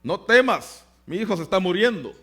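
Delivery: a desperate mother scoffing at the words; incredulous retort. Retold or imitated. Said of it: "suena medio absurdo". (madre desesperada replicando con incredulidad, más alto y fuerte)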